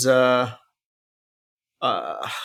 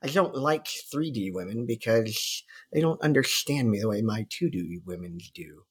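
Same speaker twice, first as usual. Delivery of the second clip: nerd voice; 5 dB quieter than usual. Retold or imitated. Mocking a nerd fan.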